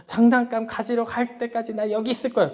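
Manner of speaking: imitating a sulking little boy; mock tantrum. Pastor imitates his son refusing to leave the car, in a mock tantrum. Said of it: "막 소리를 지르면서 막 때를 쓰면서".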